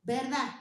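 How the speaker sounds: "Verdad" with the final d dropped; swallowed consonant. Shown as a demonstration. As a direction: end "Verdad" on an open a, swallowing the d. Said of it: "articulación blanda y deglución de consonante".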